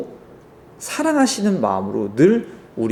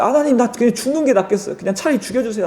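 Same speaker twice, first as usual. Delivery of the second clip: mock protest; 7 semitones higher, faster than usual. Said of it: "이렇게 막 따졌어요".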